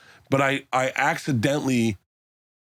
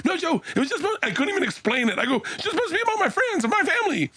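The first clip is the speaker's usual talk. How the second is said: high-pitched excuse broken by laughter; laughing squeaky excuse; faster than usual. Retold or imitated. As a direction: laugh through a high squeaky self-mock. Bert laughs retelling his excuse.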